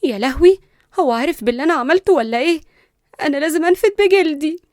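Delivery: loud, climbing alarm; fearful panic. A narrator's reading. Girl hearing him shout her name, panicked. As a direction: panic and look for an escape. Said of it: "بلعت ريقها بخوف".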